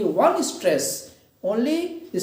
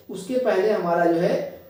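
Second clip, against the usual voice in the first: ordinary delivery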